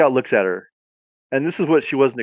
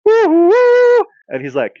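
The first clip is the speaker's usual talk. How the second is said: ordinary talk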